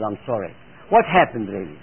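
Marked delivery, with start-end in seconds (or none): 0.0-0.5 low, soft retold apology; repentant apology. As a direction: murmur it like a sorry child. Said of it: "she repents and says".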